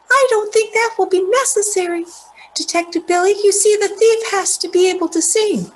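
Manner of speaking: high-pitched character voice, explaining the case; high, assured stage reading; Olivia, confident young sleuth. Acted. Reads Olivia's lines in the play.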